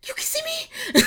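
very high astonished squeak; very high disbelieving; startled squeak. Retold or imitated. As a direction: squeak it like you've been spotted. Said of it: "I'd be like".

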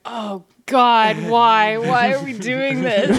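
loud, pained disbelief; exasperated groan. Retold or imitated. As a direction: cry out in dismay at the screen. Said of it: "everybody just being like".

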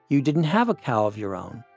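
ordinary delivery

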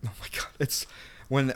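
usual speaking voice

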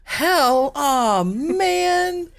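put-on high whiny child voice; whiny kid. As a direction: whine like a let-down kid. Child dismay skit.

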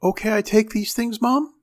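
put-on high, eager little girl asking permission; eager child. A narrator's reading. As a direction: ask mom, climbing very high at the end. Excited child asks her mother.

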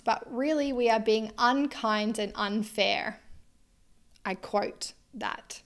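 normal talking voice

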